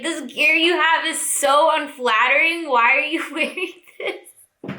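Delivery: imitating a friend mocking bad gear; hammed-up fashion critic, laughing; mock disapproval. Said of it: "he's like".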